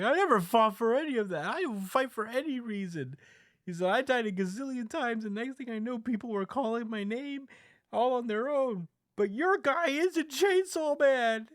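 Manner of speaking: high-pitched mock-indignant rant; acted outburst. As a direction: protest in a high, strained yell, building at the end. Retold Denji's outraged outburst.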